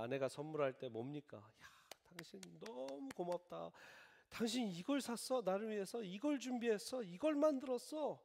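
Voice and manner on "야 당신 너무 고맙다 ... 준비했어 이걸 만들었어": delighted thanks. Pretend. Spouse thanked for a gift.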